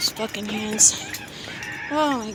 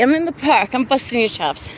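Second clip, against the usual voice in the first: usual speaking voice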